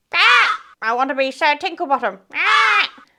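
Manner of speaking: squawk, then rattle it off like a parrot; chirpy parrot. Acted. Storyteller plays parrot demanding its title, squawking and chirpy. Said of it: "a chirpy parrot".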